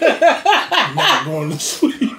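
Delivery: slow laughing tease, high and loud. Real, not acted. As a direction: drag it out while laughing. Teasing, laughing.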